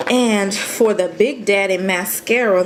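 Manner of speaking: normal talking voice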